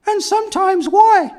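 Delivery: very high hooting why; chimp voice. Pretend. Chimpanzee finishing its vowels, very high hoot. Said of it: "chimpanzee practicing his vowels".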